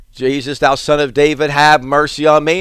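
ordinary delivery

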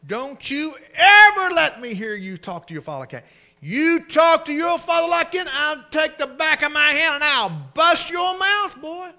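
loud, high enraged scolding; mother's furious shout. Retold or imitated. Mother yells at son for disrespecting father. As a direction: build to a yell at the boy. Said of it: "It was their mother who said".

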